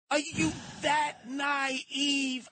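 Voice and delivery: very slow, stretched incredulous question; drawn-out disbelief. Real, not acted. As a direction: stretch every word in disbelief.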